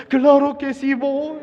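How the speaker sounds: high, bright eager agreement; eager yes. Acted. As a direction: agree with zeal.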